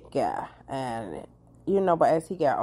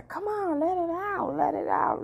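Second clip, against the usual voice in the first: high, coaxing encouragement, much higher pitch; coaxing cheer; 14 semitones higher than usual. Acted. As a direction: cheer him on to let it all out. Urging men to cry.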